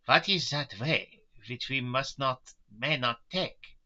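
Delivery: hoarse Van Helsing. A narrator's reading. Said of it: "asked Van Helsing in a hoarse voice".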